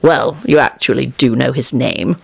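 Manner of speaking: low-pitched stern correction. A narrator's reading. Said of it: "Lupin said sternly".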